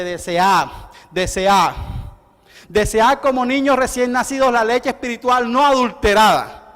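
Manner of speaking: loud repeated "desead" then a sweeping line; preaching scripture. Acted. Preacher quoting scripture, loud fervent proclamation. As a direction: proclaim the verse with force.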